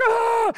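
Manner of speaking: high drawn-out imitated wail; imitated scream of a hurt child; mock child's scream. Hurt daughter as her mother comes in, mock screaming. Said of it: "and she's screaming".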